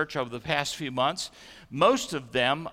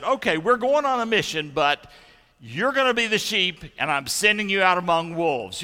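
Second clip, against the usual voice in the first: raised, louder voice as if briefing a team; mock mission announcement; 6 semitones higher, 6 dB louder than usual. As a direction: announce the mission high and loud, hamming it up a little. Acts out announcing a risky mission.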